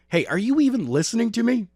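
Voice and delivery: high, fast, snappy scold; mentor's voice. A narrator's reading. Mentor scolding distracted student, high and fast. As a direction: call him back to attention, quick and high.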